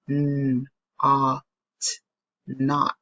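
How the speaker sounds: "n-, ot, not" split then blended; sounding out a word. Shown as a demonstration. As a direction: break "not" into sounds slowly. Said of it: "has the ah sound".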